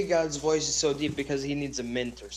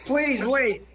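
calling out; 7 semitones higher than usual. Real, not acted. Calling after God who leaves.

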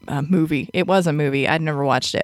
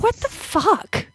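high, slow disbelief; incredulous; 12 semitones higher, slower than usual. Real, not acted. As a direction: drag it out in shock. Disbelief at passage.

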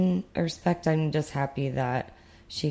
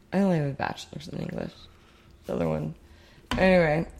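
ordinary talk

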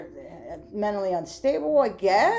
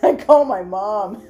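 ordinary talk

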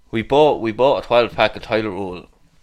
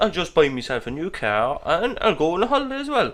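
mocking; 9 semitones higher than usual. Mocking his friend's spending.

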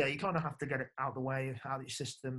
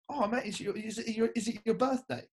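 bright, higher-pitched chummy coach voice; cheery coach; 9 semitones higher, 3 dB louder than usual. Retold or imitated. Coach quizzing the player at breakfast, higher and chirpy. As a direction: play the oblivious coach asking keenly. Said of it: "the coach goes".

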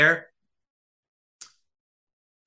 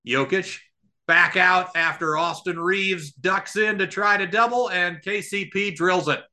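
raised, energetic game call; live play-by-play; 4 semitones higher than usual. Acted. Podcast host calling the game live for his listeners, energetic play-by-play. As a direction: call each play as it happens, pitched up.

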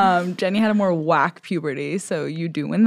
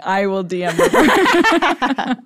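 mock solemn vow; 4 semitones higher, much slower than usual.

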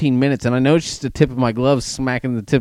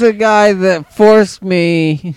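high, very slow, loud slurred quote; mock drunk girl; 8 semitones higher, 8 dB louder, much slower than usual. Friend imitates the drunk girl's accusation in a high, drawn-out voice.

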